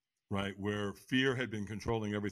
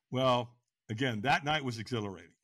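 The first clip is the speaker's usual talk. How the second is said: ordinary delivery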